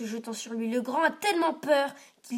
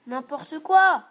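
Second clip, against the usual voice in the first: sulky retort; 4 semitones higher, 12 dB louder, slower than usual. A narrator's reading. Simon sulking rudely.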